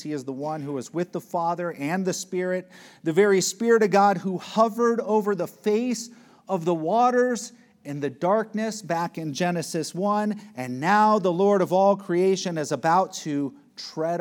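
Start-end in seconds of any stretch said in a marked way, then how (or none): 3.0-14.2 louder and higher, building the sermon; preaching at full force. Acted. Preacher builds the Genesis link for listeners, loud preaching. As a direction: lift the voice as the point grows.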